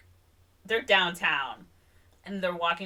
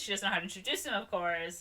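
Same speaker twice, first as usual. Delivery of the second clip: ordinary delivery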